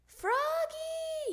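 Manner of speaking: high, loud, stretched name-call; yelling. Acted. Dad fed up with the thumping, high loud yell. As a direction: yell upstairs at the child. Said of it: "yelled Dad".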